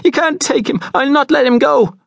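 high, frantic screamed protest. A narrator's reading. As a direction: scream as a desperate mother fighting for him. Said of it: "his mother screamed again".

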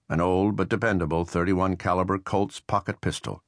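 ordinary delivery, nothing marked

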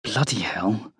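hushed whisper of disbelief; soft, voiceless whisper. A narrator's reading. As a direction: whisper it under your breath. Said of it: "adds to the whispered comments".

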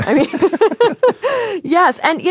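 high, laughing agreement; real laugh spilling into the words. Real, not acted. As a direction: break up laughing, then agree. Laughing at own bananas quip.

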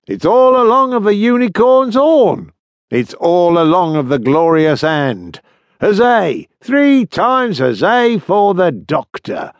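high, loud sailor's cheer; called out. A narrator's reading. Sailor hails the Doctor before the crew, calling out. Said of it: "called out".

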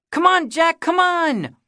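put-on high, loud, fast little-boy pleading; boy begging his hero for grounders; begging kid. A narrator's reading. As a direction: wheedle like a kid who wants to play. Kiki begging to play.

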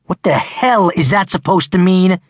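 high-pitched angry bark. A narrator's reading. Offended boy barking back at the one taunting him. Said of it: "Hayden barked".

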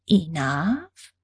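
sweetly mock the word back, rising; condescending sugary alien tone; syrupy sweet. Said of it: "Her tone was syrupy sweet".

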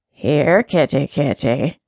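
low, quiet, mocking old cat voice; quiet sarcastic cat. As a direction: coax her in with a low sneer. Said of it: "said a quiet voice, sarcastically".

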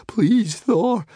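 high, pleading prayer; frightened high-pitched plea; begging. A narrator's reading. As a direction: beg desperately in a high voice. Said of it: "begged Fishlegs".

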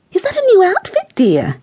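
high-pitched, prying question; nosy neighbour. A narrator's reading. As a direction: put on a fussy neighbour's high voice.

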